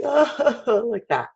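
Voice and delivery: high, pretend bawling "oh"; mock crying. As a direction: fake a short high wail of crying. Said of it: "if someone was crying and they're going".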